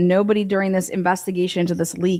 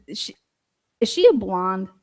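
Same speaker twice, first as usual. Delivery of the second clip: ordinary talk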